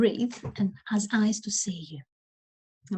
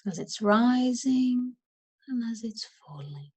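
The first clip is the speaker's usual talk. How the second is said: unhurried, softening as the questions go on; guided meditation; 2 semitones higher than usual. Acted.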